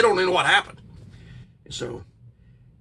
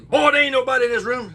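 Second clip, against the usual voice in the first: higher, louder stern father; gruff dad; 8 semitones higher, 5 dB louder than usual. Retold or imitated. Father tells son nobody is there, louder stern voice. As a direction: bark it like an annoyed dad. Dad dismisses boy's claim.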